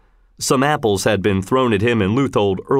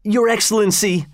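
higher-pitched, understated imploring cry; 10 semitones higher than usual. A narrator's reading. Grandfather kneeling before the governor, mildly imploring cry. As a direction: call to him with a touch of desperation. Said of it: "he cried".

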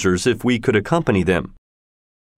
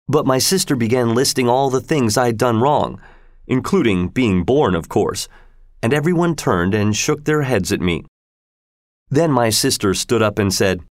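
plain reading voice

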